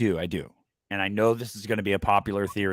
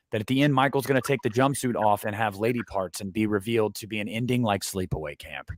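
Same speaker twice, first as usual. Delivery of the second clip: ordinary delivery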